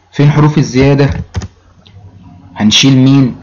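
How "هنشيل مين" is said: ordinary talk, nothing marked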